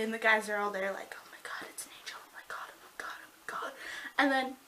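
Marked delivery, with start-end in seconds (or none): 1.2-3.7 imitated stunned onlookers whispering; hushed, voiceless mock awe; awed whisper. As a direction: whisper it under your breath in mock shock.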